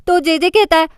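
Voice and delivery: plain reading voice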